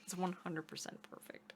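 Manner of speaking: a breathless whispered aside; hushed, delighted whisper. Real, not acted. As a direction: whisper it under your breath. Whispered aside praising the accent.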